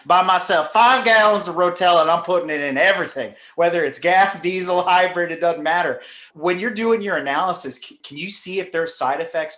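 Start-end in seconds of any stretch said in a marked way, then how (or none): 0.0-6.0 Mountain Man Dan impression. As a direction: play a gruff country good ol' boy. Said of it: "my best Mountain Man Dan impression".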